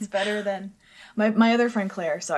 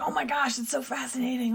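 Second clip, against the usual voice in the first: higher-pitched imitation of a fascinated friend; imitated fascination; 6 semitones higher than usual. Imitating her friend's reaction to Chinese medicine, mildly gushing. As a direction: gush lightly, as the friend would. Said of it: "so she's like".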